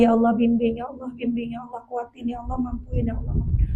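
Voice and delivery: very hushed, repeated pleas to God; murmured pleading prayer. Acted. Speaker models asking Allah for guidance and strength, hushed pleading. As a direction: plead under your breath, fading almost to nothing. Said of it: "terus minta lagi sama Allah".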